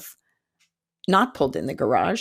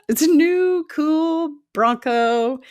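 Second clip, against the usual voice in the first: high, drawn-out "new, cool"; showing off proudly; 9 semitones higher, 4 dB louder, slower than usual. Real, not acted. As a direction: brag about it, stretching the words high. Bragging about her new car.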